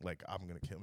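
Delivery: low, hushed retold threat; quiet menace. Telling boys dragging him across the street, quietly menacing. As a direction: vow it softly, pitch dropped right down.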